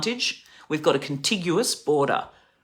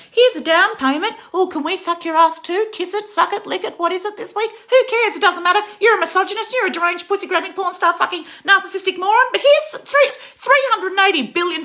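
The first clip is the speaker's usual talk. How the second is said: much higher, louder squealing grovel; mocking Angus Taylor; 6 dB louder than usual. Mocking a politician grovelling to Trump, shrill and loud. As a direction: squeal it like a fawning crawler. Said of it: "Angus Taylor's running over there ... going".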